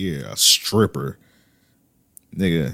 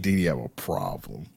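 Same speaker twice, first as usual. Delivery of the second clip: mocking imitation; 7 semitones lower, 9 dB quieter than usual.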